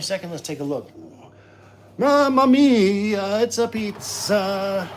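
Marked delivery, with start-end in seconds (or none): 2.0-4.9 slow, high, booming Italian chef cry; jolly italian pizza chef. Pretend. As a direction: belt it like a proud pizzaiolo. Pizza check, Italian chef exclamation.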